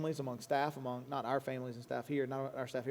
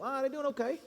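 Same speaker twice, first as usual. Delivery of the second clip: mock casual reply; 10 semitones higher than usual. Acted answer about kids.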